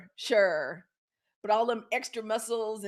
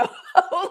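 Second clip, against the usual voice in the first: short high burst of laughter; real laugh at her own joke; 10 semitones higher, 6 dB louder than usual. Real, not acted.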